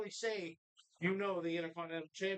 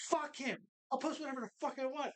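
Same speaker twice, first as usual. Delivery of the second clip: retold defiant outburst; 7 semitones higher than usual. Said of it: "So I was like".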